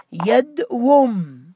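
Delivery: يدوم drawn out very slowly as yadwum, the w sounded; slow original form. Shown as a demonstration. Teacher showing students the original form, slowly. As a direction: stretch يدوم slowly to show the hidden waw. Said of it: "الأصل أن أقول ماذا؟".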